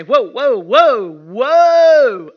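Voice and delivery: loud, drawn-out whoas building to a holler; Mama's alarmed halt. Retold or imitated. Mama halting squabbling children, imitated building holler. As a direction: imitate Mama hollering to stop, each longer. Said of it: "you know what Mama say?".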